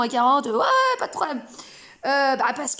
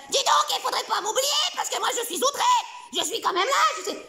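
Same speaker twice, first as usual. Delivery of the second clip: outraged bestiole; 9 semitones higher than usual. Acted. Insulted bestiole protests to the coach, high outraged squeal. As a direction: shriek your offence like a prissy little creature. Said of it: "la bestiole, elle va".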